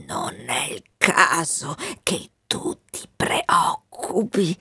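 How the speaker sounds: slow, half-whispered reassurance; breath-starved and fading, slowly; weak, weary. Acted. Dying colonel tells the Wind not to worry, weak and weary.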